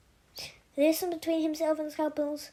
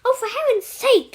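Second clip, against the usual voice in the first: much higher, louder outraged cry; indignant exclamation; 9 semitones higher, 9 dB louder than usual. Acted. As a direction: exclaim in full indignation at the cuffs. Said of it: "she exclaimed ... full of indignation".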